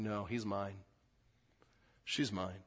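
quiet and sure, like a parent holding on; tender reassurance. Retold or imitated. Holy Spirit reassures the believer, soft and tender. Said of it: "the Holy Spirit's inside saying".

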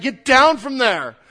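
retold alarmed yell, loud and high; freaked-out shout. Susie yelling at a kid on the rocks, freaking out. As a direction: shout a panicked order down from below. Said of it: "She was maybe freaking out".

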